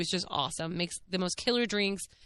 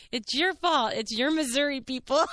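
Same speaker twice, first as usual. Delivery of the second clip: mock blame; 6 semitones higher than usual. Acted mock blame to the cop.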